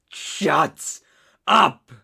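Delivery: two loud, separated angry words; angry outburst. Acted. Captor silencing his interrupting captive, angry outburst. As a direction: shout it as a furious command.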